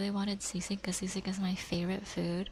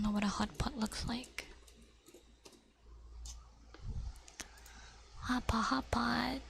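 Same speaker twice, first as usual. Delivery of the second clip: ASMR whisper. Acted. Whispering to viewers about fish cake. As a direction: whisper it close to the mic.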